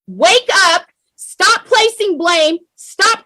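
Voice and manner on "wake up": ordinary talk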